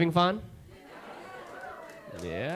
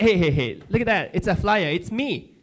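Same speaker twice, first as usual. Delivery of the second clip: loud, higher, eager pointing; 4 semitones higher, 4 dB louder than usual. Retold or imitated. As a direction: urgently point it out. Showing officer the flyer eagerly.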